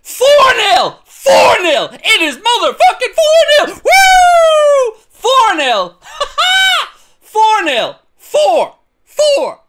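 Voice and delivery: high, loud repeated goal roar; ecstatic goal shout. Real, not acted. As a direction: scream the score over and over. Fourth goal celebration.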